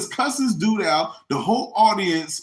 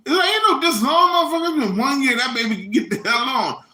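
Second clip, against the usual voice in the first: high, louder angry retort; angry guest; 8 semitones higher, 3 dB louder than usual. Retold or imitated. Tyrone yelling back at Maury, high angry retort. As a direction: snap back at the host. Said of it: "Tyrone answering host".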